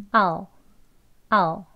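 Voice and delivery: "아오" as one short syllable, nearly "al"; very short reduced I'll; shorter reduction. Shown as a demonstration. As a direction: model a quick, clipped "아오" for I'll. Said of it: "이제 더 짧게".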